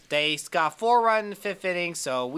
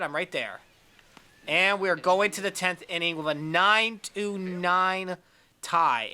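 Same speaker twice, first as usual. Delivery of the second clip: ordinary talk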